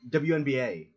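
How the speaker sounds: W N B A rattled off as one familiar name; quick rhythmic W N B A; W N B A with no gaps between letters; fast, run-together letters. Shown as a demonstration. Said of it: "fast and with, like, a rhythm".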